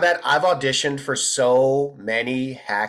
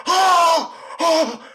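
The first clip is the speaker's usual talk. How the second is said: high, loud mock scream; hammed-up anguished cry, pitched way up; overacted wail; 3 dB louder than usual. Bad actor auditioning as a desperate father, overacted screaming. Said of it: "he's, like, screaming".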